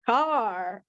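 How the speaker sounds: ordinary talk, nothing marked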